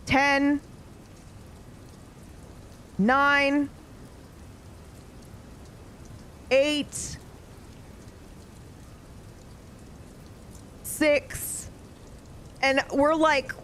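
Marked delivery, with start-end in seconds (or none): 0.1-11.7 slow, held monotone count with long pauses; imitating a sleeper's eerie countdown; full-volume sleep countdown. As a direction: count aloud in your sleep, each number sustained and level. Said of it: "suddenly says, full volume".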